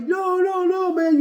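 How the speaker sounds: high, loud retold cry of no; imitating a desperate high shout; anguished cry. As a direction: cry out over a fallen friend. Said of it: "And he's like".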